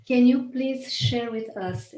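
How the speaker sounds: normal talking voice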